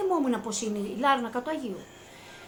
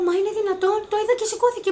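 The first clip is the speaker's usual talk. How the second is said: astonished; 9 semitones higher, 5 dB louder, faster than usual. Retold or imitated. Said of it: "Της λέω".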